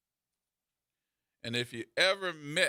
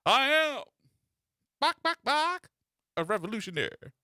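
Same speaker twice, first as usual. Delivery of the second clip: hammed-up clucking bird, much higher; clucking bird revolutionary; 9 semitones higher than usual. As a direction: crow it out like a chicken declaring revolution. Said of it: "Big birds with A K forty-sevens talking about some".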